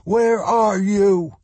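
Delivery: high, slow, drawn-out yell. A narrator's reading. Boy yelling for missing parents in the rubble, yelling. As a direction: call out high and long, searching. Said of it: "he yelled".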